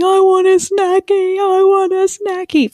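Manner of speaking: put-on high, slow whiny toddler plea; mock toddler sobbing. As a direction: imitate the sobbing toddler in the car. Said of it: "She was sobbing and just saying".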